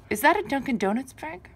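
ordinary talk, nothing marked

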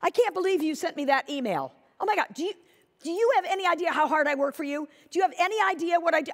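mimicking an upset agent on the phone; upset defensive rant. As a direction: rattle it off hurt and offended. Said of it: "It sounded something like this".

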